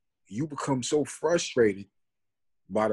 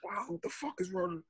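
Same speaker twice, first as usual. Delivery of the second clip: shocked friends; 8 semitones higher, 8 dB quieter than usual. Retold or imitated.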